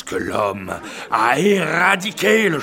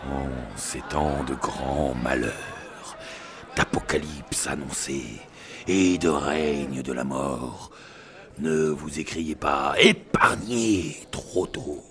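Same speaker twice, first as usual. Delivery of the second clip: ominous narration; 11 semitones lower, 4 dB quieter than usual. Acted. Doom-laden dramatic narration.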